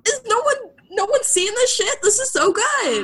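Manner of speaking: high-pitched, incredulous excitement; amazed outburst. Retold or imitated. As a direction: re-enact being floored by a discovery. Acting out own amazed reaction.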